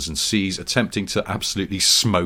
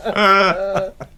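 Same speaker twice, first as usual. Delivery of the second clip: laughing; 9 semitones higher, 3 dB louder than usual. Real, not acted. Giggling through a silly image.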